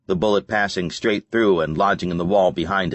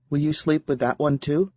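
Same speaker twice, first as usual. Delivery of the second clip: higher teasing woman's voice; Serena voice; 8 semitones higher than usual. A narrator's reading. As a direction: tease him lightly, voice raised.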